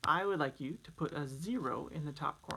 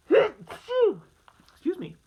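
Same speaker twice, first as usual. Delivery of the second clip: loud sneeze mid-word; sneezing; 13 semitones higher, 12 dB louder, much slower than usual. Real, not acted. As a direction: sneeze through the words. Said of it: "I'm gonna sneeze".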